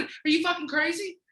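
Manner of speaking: high, loud disbelieving yell; can't believe she doesn't know him; yelling in disbelief at a clueless coworker; incredulous shout. Retold or imitated. Incredulous retort to friend.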